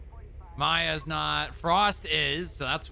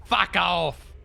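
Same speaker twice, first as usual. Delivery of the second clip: jokey Cockney brush-off, louder; mock British accent; 3 semitones higher, 5 dB louder than usual. Cop bantering in mock British accent. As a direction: toss it off like a London lad. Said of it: "talking like I'm British".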